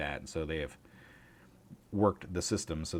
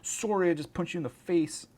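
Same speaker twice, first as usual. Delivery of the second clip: mock Canadian; 9 semitones higher, 4 dB louder than usual. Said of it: "throwing punches and saying sorry".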